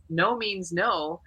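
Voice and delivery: no means no spelled out slowly and firmly; mild retold firmness, slow. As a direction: lay down the rule, one slow word at a time. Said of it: "And I go".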